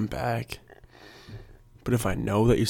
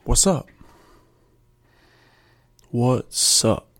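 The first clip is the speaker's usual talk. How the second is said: louder, hammed-up confrontation; mock challenge; 2 semitones higher, 5 dB louder, much slower than usual. Calling out rice cake critics, mock-challenging. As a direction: square up to them, playfully loud.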